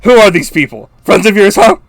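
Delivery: high, loud outburst quoting the film; hammed-up pissed-off character voice; exasperated movie quote. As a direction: blurt it out loud and high, mock indignant. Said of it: "the way he says that cracks me up".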